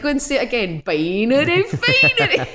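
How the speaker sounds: high, loud, slowed-down mock Scottish accent; badly imitated Scottish accent. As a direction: repeat it in a hammed-up Scottish accent. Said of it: "she does my s-, accent really badly".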